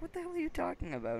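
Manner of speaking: high, quick, incredulous retelling; indignant counselor. Retold or imitated. Camper retells his counselor's reaction, mock indignant.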